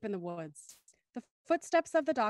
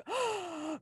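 sharp dramatic intake of breath; host hamming up the big moment with a gasp; mock gasp; 6 semitones higher, 4 dB quieter than usual. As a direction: gasp for effect. Host mimics shock while asking.

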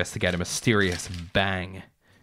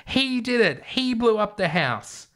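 put-on old lady screaming; 11 semitones higher than usual. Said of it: "burst into tears and screamed".

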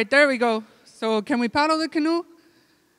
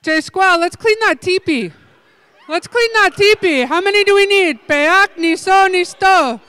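calling out; 4 semitones higher, 5 dB louder than usual. Real, not acted. Calling the game and counting.

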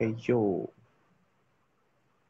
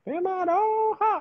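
crying. Real, not acted. Searching for friend, cry heard.

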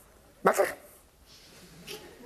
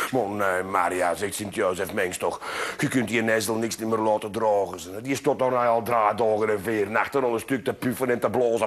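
lower, calm grumbling man; gruff old carpenter; 8 semitones lower than usual. Acted. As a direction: shrug it off like a lazy carpenter. Jozef voiced low.

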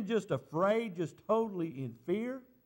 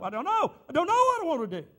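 high, loud, rushed cry of not knowing; panicked reply; 10 semitones higher, 8 dB louder, faster than usual. Retold or imitated. A pastor answers his wife in a panic, rushed high cry. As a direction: blurt it out fast and frightened.

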